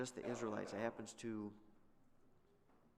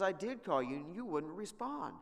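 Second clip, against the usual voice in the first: louder, higher mock-annoyed comeback; defensive retort; 6 semitones higher, 5 dB louder than usual. Jephthah answers the complaining Ephraimites, defensive. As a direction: throw the blame back, raised voice.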